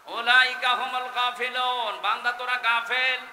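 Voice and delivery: high, loud declamation; shouted rebuke. Real, not acted. Preacher tells the servants they are heedless, high shouted verse.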